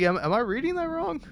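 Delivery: puzzled. Retold or imitated. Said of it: "I was like".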